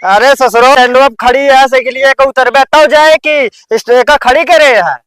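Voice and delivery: loud exasperated shouting. Acted. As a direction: yell at him in frustration. Shouting at the drunk.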